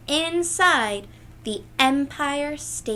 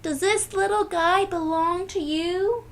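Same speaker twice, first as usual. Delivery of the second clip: a lightly voiced friendly biker, a touch higher; easygoing stranger returning the dog, lightly voiced; 7 semitones higher, 4 dB louder than usual. A narrator's reading. Biker hands back the lost dog, lightly voiced and friendly. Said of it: "the biker asks".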